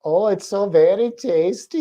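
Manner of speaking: lightly voiced German lizard, slow; mock German accent. Owner voicing the lizard eating, in a light mock German accent. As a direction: savour it in a hint of German accent. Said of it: "why she's German now".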